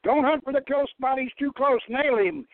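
high, urgent battlefield command; commander's order. Acted. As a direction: order him to fire, now. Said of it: "Enoch, the tank commander, ordered".

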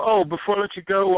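usual speaking voice

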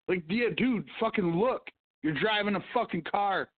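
ordinary talk, nothing marked

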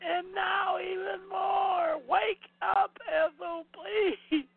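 high, loud pleading yell; exasperated shouting. Real, not acted. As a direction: yell at her to wake up. Yelling at the movie.

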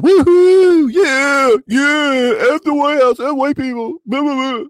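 high, hammed-up whooping crowd voice; mock the cheering fans, way up high.

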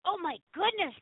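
usual speaking voice

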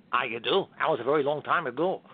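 low, dry veteran host; Johnny Carson. Acted. Old host replies to admiring comedian, low dry impression. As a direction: answer low and unimpressed. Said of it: "I remember the first time I did your show".

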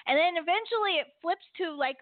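ordinary delivery

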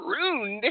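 "ruined" as one laughing, high beat, ROOND; squashed into one syllable. Retold or imitated. Cracking up at a friend's mispronunciation, one squashed syllable. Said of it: "you turn them into one".

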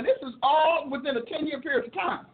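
ordinary delivery

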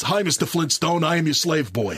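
a hint of an old film star reading his lines; Tony Curtis impression. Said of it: "it's just that Tony Curtis voice".

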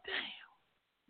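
breathy whispered damn. Retold or imitated. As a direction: whisper it to yourself, dismayed. Imagining himself old, dismayed.

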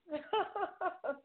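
high falling chuckle; amused laugh. Real, not acted. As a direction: laugh at the dark picture. Host sees dark camera image.

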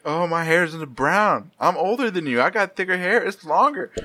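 high, mocking sing-song of bragging cousins. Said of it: "they're like".

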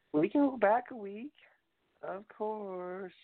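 jumps up high and squeaky. Acted. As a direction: pop the phrase up into falsetto. High silly retake of phrase.

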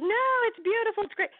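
imitating a gushing friend. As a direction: mimic her friend insisting it's lovely. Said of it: "But she was like".

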